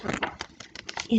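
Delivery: ordinary delivery, nothing marked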